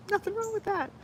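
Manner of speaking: high squeaky line through laughter; laughing. Real, not acted. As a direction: squeal it out giggling. Defending loving Legos, laughing.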